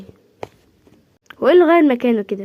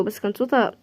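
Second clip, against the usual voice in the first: ordinary talk, nothing marked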